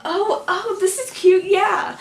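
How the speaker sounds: high, loud flustered delight; pleased surprise. Retold or imitated. Fan reacting to his arms around her, high pleased surprise. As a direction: react pitched right up, delighted.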